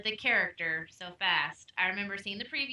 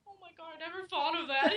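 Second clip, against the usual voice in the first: mildly imitating tearful husband; 7 semitones higher than usual. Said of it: "he started crying".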